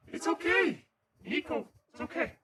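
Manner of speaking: high, bright-pitched reassurance to a dog; high-pitched "there, there" to a pet; reassuring pet talk. Real, not acted.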